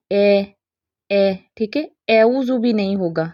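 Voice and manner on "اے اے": "اے" spread wide into an ay sound; over-stretched vowel. Shown as a demonstration. Teacher showing students the mistake, over-stretched ay. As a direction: stretch the lips too far on "اے". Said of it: "نہ تو زیادہ اے اوزو کرنا ہے".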